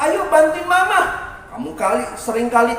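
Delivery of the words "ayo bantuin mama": raised, louder mom voice; mother giving orders. Acted. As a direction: call the child to help. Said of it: "Disuruh mama".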